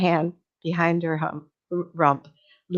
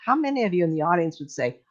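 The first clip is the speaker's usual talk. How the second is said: ordinary delivery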